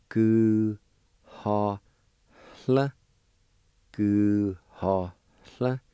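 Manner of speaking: "Gu, ha, hl" said syllable by syllable, with a pause after each; Cherokee syllables. Shown as a demonstration. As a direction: sound out "gu, ha, hl" slowly, twice over. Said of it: "It's pronounced like this".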